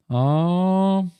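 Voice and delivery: mid-yawn. Real, not acted. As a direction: yawn through the word. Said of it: "I don't even know why I'm yawning".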